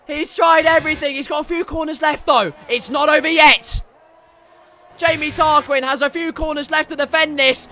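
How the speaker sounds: race play-by-play. Real, not acted. Commentator calling the last few corners, urgent play-by-play. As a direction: keep the suspense alive.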